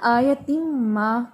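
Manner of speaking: slow, drawn-out recitation of the pause; recited stop point. Shown as a demonstration. Teacher showing student where she stopped, drawn-out recitation. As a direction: stretch the words out to show the stop. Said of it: "अगर आप stop करती हैं ना".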